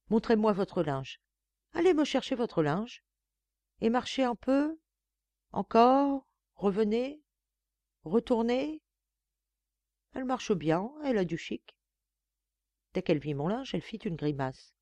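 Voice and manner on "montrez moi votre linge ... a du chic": higher, imperious lady of the house; higher-pitched bossy madame; haughty mistress. A narrator's reading. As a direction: talk down to the new maid from on high. Narrator voices Madame.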